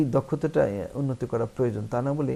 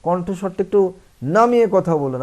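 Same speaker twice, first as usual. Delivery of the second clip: higher and louder rude order; rude loud demand; 6 semitones higher, 8 dB louder than usual. Shown as a demonstration. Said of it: "আমরা বলি না".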